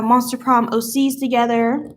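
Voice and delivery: ordinary talk, nothing marked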